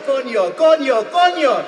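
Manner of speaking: high, loud shouts climbing with each repeat. Acted. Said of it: "says it louder and louder and louder".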